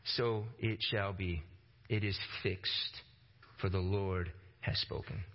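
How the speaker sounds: low, slow and grave, each phrase weighed; solemn decree. Acted. Preacher declaring God's fixed judgment, low and slow. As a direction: pronounce it like a final verdict.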